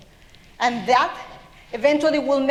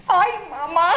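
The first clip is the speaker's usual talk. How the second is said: higher, short yelp for mama; brief comic cry of alarm; mock fright; 4 semitones higher than usual. Papa sees the cat.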